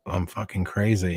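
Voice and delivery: ordinary talk, nothing marked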